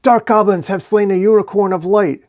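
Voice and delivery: high, loud heroic proclamation; battle cry. A narrator's reading. Knight accusing the dark goblins, loud proclamation. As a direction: declaim it loudly at the enemy.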